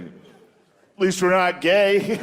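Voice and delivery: high, very slow drawn-out retort; mock comeback. American abroad answering criticism, high mock retort. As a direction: stretch out a silly, high comeback.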